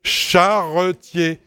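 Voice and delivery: "Charretier" slowly split, char-re-tier; syllable by syllable. Shown as a demonstration. Teacher explaining who drives the cart, slow three-syllable "Charretier". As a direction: draw out each syllable of "Charretier". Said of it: "J'ai bien dit charretiers".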